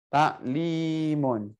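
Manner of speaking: slow, with a long middle vowel; drawn-out announcement. Shown as a demonstration. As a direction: hold the middle syllable, then let it fall. Slowly repeating the search word.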